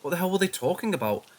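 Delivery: ordinary delivery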